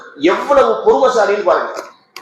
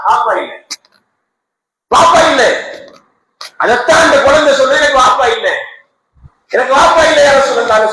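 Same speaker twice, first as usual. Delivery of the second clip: put-on child crying out; 5 semitones higher, 3 dB louder than usual.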